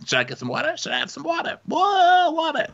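high-pitched, scatty impersonation; hammed-up confused socialite, pitch shooting up; Ramona. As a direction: climb to a squawked, baffled mock cry. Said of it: "[name] does her Ramona impersonation".

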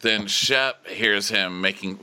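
ordinary talk, nothing marked

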